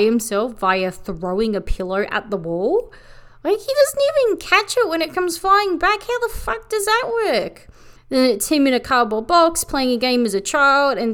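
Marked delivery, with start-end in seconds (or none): 3.4-7.7 high-pitched, baffled squeal; incredulous. Real, not acted. Reviewer baffled by the impossible pillow fight, incredulous. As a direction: ask it in high-pitched disbelief.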